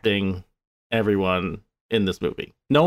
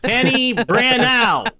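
slow, high, loud drawn-out name; hammed-up announcer call; 5 dB louder, slower than usual. Co-host clowning with the actor's nickname, hammed-up loud call. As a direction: belt out the name slowly like a mock introduction.

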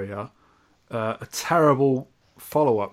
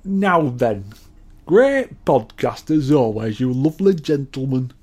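Sol impression; 4 dB louder than usual. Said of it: "So this is our Sol".

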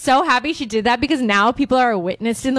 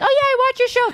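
much higher, chirpy fan voice; imitating a fan; 11 semitones higher than usual. Co-host imitating a fan meeting her, higher gushing. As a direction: gush like an eager fan. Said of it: "she was like".

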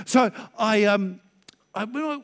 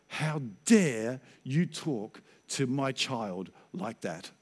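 slow, low, weighty rebuke; very stern voice; 6 semitones lower, 5 dB quieter, much slower than usual. Retold or imitated. God rebuking the pastor in his head, very stern. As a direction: draw out each word sternly, quieter and lower. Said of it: "in a very stern voice".

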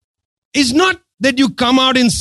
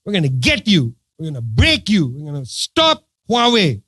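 low-pitched mock gangster threat; mock tough talk; 6 semitones lower, 3 dB quieter than usual. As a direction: put on a low, menacing tough-guy act. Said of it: "just talk tough with China".

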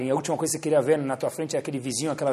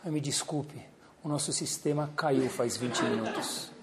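quiet fake apology; 9 dB quieter than usual. Agent apologising, system down.

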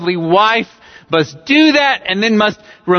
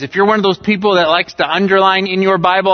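ordinary talk, nothing marked

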